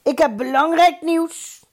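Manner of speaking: high, projected announcement; calling out. A narrator's reading. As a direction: call out important news. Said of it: "riep Plonius".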